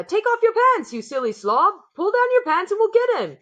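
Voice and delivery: another cook shouting. A narrator's reading. Fellow cook yells advice to the panicked cook, jeering. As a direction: bark orders with a mocking grin.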